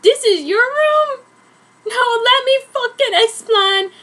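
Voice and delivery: high, loud, slowed mock protest; hammed-up yell. Edward caught in the bedroom pleading his case, high hammed-up yell. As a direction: overact the panicked defence.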